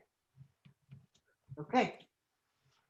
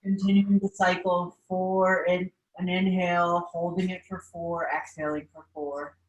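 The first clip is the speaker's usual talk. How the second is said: guided meditation. Acted. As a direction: guide the class through breathing, unhurried. Leads a seated meditation.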